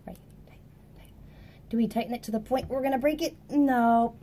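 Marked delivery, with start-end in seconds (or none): 0.1-1.1 whisper. Real, not acted. Teacher tightening the ligature screws, whispering. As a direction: whisper "Right, tight, tight" under the breath while tightening. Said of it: "tightening screws".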